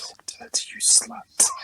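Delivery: mock seductive whisper; sexy whisper. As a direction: tease in a breathy whisper.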